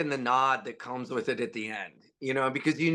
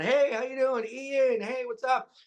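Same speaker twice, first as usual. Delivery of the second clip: much higher cheery greeting voice; friends greeting him; 11 semitones higher than usual. Retold or imitated. Friends greeting him on his bike, high and cheery. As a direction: call out a bright high hello from a bike. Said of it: "I'll see friends around".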